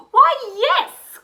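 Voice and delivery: high, loud glad cry; an eager yes, pitched high and loud; cried. A narrator's reading. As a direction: witch cheerfully welcoming the bird, loud and high. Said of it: "cried the witch".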